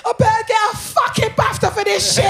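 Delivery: high, loud mock-tearful complaint; upset wail. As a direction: imitate yourself wailing in comic despair. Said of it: "just being so upset".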